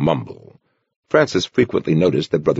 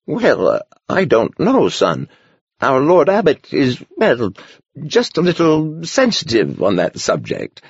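lightly voiced old monk, unsure and higher; a startled old monk hedging; hesitant old man; 9 semitones higher than usual. A narrator's reading.

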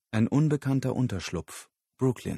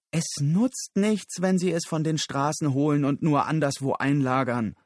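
high, raised, indignant voice; angry protest; 6 semitones higher, 5 dB louder than usual. Acted. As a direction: argue back hotly. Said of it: "Susan kochte vor Wut". (aufgebrachter Protest)

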